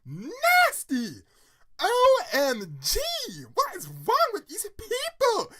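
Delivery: loud, very high disgusted shrieking; drama-queen outburst. Real, not acted. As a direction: go off in theatrical horror, stretching the words. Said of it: "I'm sorry if I sound like a drama queen".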